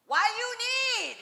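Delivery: put-on high, slow, broken English Korean mom accent. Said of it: "my mother looks at this woman and says".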